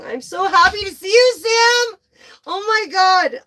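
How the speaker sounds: high, loud, delighted cry out to Sam; overjoyed shout. Real, not acted. Tarot reader cheering on viewer Sam, overjoyed shout. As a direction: burst with joy for Sam.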